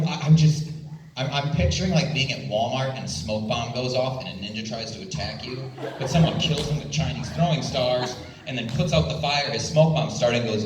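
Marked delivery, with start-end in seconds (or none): none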